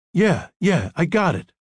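quick eager agreement; excited. A narrator's reading. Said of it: "His voice was excited".

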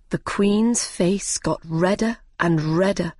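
plain reading voice

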